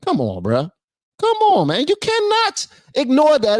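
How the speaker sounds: high, insistent appeal; exasperated protest. Real, not acted. Protesting to the candidate.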